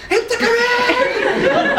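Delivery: very high, loud, drawn-out mum shriek; hammed-up screeched order; mock mum yell. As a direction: shriek it slowly like an exasperated mother. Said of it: "And then your mum goes".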